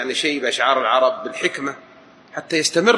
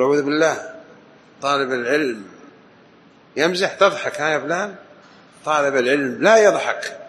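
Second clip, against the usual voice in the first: ordinary delivery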